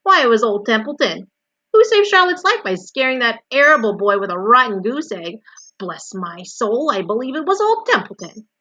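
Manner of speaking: loud, indignant self-praise; self-important boast. A narrator's reading. Old Templeton claims credit for rescues, boasting. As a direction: crow about your own good deeds. Rat boasts rhetorically to Wilbur.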